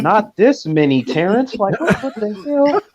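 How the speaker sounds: hammed-up alarm, voice climbing; panicked friend. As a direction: friend in the kitchen panicking about the crowd, high and rising.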